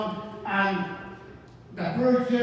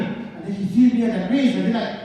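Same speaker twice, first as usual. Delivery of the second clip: ordinary talk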